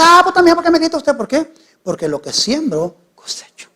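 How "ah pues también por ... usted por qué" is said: high-pitched, put-upon complaint; much higher voice, playfully aggrieved; mock indignant protest. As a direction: imitate an offended churchgoer answering back. Acted protest of yelled-at member.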